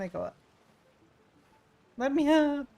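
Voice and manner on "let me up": higher, louder exasperated yell; frustrated shout. Real, not acted. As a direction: yell at the game to let you up. Streamer stuck, cannot climb up.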